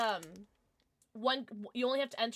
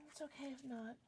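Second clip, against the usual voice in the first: much softer resigned repeat; quiet; 2 semitones higher, 14 dB quieter than usual. Real, not acted. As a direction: drop it to a near hush after a sigh. Repeats after a sigh, much softer.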